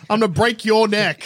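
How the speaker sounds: barbarian. Pretend. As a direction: bellow the threat like a brute. Threatening Green Goblin.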